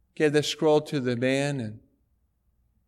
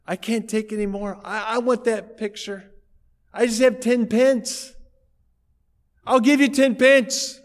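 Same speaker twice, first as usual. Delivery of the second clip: rising higher and louder as he pleads; earnest plea; 7 semitones higher, 4 dB louder than usual. Retold or imitated. Soldier pleading to buy the son's picture, building earnestly.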